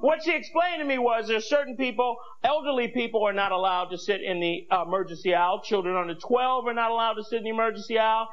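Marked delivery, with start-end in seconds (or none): none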